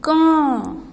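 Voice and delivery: क said as a nasal कँ, through nose and mouth; nasal kaan sound. Shown as a demonstration. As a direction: model क with a long nasal hum. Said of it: "अब मेरे साथ साथ बोलना". (नाक और मुँह से कँ बोलिए)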